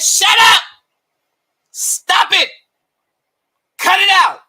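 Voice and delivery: drown him out; shouting him down. Real, not acted. Shouting down Teofimo.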